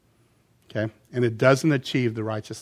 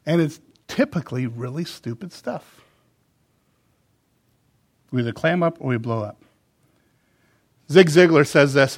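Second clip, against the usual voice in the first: ordinary delivery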